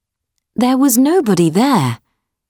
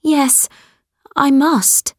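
higher, earnest daughter voice; pleading daughter, higher pitch; young girl voice; 7 semitones higher than usual. Acted. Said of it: "she said".

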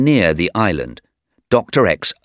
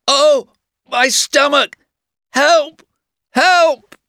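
high-pitched cries of pain and alarm; very loud shout; 5 dB louder, slower than usual. A narrator's reading. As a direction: cry out for help as if in agony. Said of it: "Then he shouted very loudly".

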